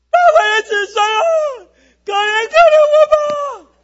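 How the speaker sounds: desperate full-force howl, very high; wolf-howl scream. Shown as a demonstration. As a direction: scream for mercy like a howling wolf. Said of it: "是狼的嚎叫的声音".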